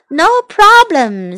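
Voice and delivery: slow, drawn-out sleepy reply; high, lazy, drowsy sing-out. A narrator's reading. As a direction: stretch it out drowsily, acted. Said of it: "said Teddy sleepily".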